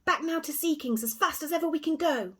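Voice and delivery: high little squeaky voice, urgent; tiny reedy squeak. A narrator's reading. Tiny man urging the mouse to flee, high squeak. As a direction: squeak like a buzzing fly, hurrying. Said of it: "his voice made a little reedy squeak".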